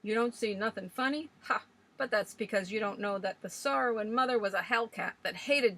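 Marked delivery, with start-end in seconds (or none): 1.4-1.6 short mocking laugh; scornful bark of a laugh. A narrator's reading. Old Swales scoffing at the sorrowing mother's epitaph, one mocking laugh. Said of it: "why are you laughing?".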